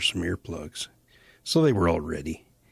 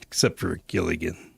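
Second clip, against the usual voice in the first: usual speaking voice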